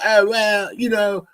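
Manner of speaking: high, mocking imitation of the preacher. Mimicking the preacher's pompous musing to the listeners, lightly voiced. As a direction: lift the voice and ham up his pompous musing. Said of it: "And he was like".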